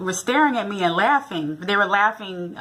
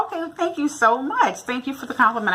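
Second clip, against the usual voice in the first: bright gracious thanks; 7 semitones higher than usual. Retold or imitated. Her gracious reply.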